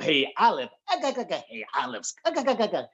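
high, cackling Popeye laugh; Popeye impression. Comedian mimics Popeye talking to Olive, cartoon voice. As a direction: do a quick Popeye the Sailor bit. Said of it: "like Popeye the Sailor".

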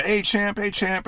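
high, punchy mock greeting; gym buddies' hail. Gym mates hailing him, imitated.

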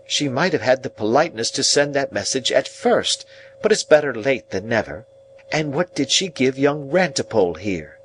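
grumble, then demand an answer; a touch of tart reproach; mollified scolding sister. Acted. Narrator voices the sister.

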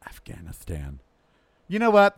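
usual speaking voice

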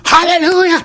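very high, hammed-up praise cry; mock church shout. Congregant carried away by the church music, mock ecstatic cry. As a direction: squeal it like a parody congregant.

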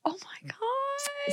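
breathless whisper, slow; whispered awe. Real, not acted. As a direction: whisper it in amazement. Reacting to house news.

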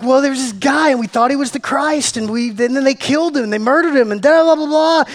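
high, loud, rushed mock recounting; rattled-off lament. Disciples pouring out their news to Jesus, hammed-up gushing. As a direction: gush the whole story at the stranger. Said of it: "They're like".

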